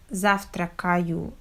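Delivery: завтракаю with every vowel fully pronounced, ЗАВ-ТРА-КА-Ю; завтракаю with full, unreduced A in every syllable; over-clear vowels. Shown as a demonstration. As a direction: wrongly spell out each syllable of завтракаю. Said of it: "clear pronunciation of vowels".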